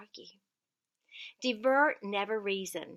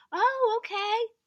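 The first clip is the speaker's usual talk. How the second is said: soothing agreement; 13 semitones higher, 9 dB louder, slower than usual. Retold or imitated. Agreeing gently with confused man.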